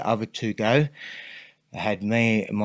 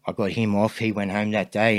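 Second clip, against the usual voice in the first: ordinary talk, nothing marked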